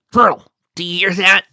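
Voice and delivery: alert call. Acted. Bobby alarmed at rustling branches.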